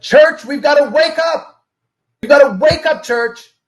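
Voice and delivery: booming preacher. Retold or imitated. Pastor at the podium calls the sleepy church awake, booming. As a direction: rouse the whole congregation from the podium.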